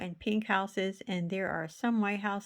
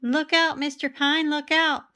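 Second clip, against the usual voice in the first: higher, louder warning shout; boys calling out; 7 semitones higher, 8 dB louder than usual. Acted. Boys in the street warning Mister Pine, shouting. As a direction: call out to warn him from down the street. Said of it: "the boys called".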